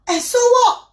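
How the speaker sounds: high, loud dismissive retort; scolding neighbour. Retold or imitated. Neighbour brushing off the aunt's death, high and loud. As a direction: fling it back, sharp and high. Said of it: "Elle dit, et puis quoi?".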